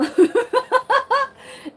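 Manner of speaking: quick high laugh; high giggle. Real, not acted. Laughing while explaining the sports bra.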